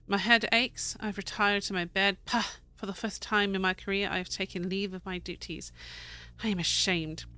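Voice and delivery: steady, storytelling reading of a keeper's journal; diary read aloud. Acted. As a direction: read the old journal like a tale. Reads diary entries aloud.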